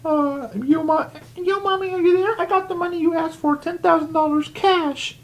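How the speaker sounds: put-on very high, childlike calling; little girl voice. Kendall in her dream calling for Mommy, tiny child voice. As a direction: squeak it out like a small girl. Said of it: "She's like".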